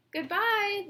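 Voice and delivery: high, loud farewell call; calling out goodbye. A narrator's reading. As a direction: call it out across the room. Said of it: "the students called".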